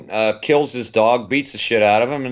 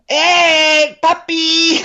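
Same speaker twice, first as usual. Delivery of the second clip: high put-on voice, much higher and louder; Leguizamo voice; 6 dB louder, much slower than usual. Mocking how Leguizamo does family voices, high and loud. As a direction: squeak it like a cartoonish uncle. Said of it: "it's just like".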